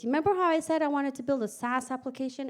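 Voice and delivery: normal talking voice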